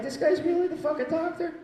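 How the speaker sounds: high-pitched disbelieving question; mock incredulity. Patients eye the comic as their doctor, high mock disbelief. Imitating a doubting look.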